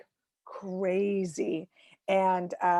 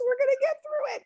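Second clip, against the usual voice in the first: mock tearful vow; faster than usual.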